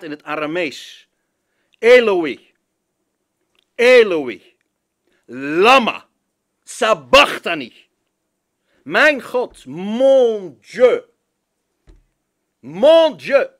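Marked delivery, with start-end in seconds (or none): 1.8-7.7 a loud anguished cry to heaven. Acted. Preacher voicing Jesus on the cross, loud anguished cry. 8.8-13.5 high, loud cry of despair. Acted. Preacher echoing Jesus' cry in French, loud and high.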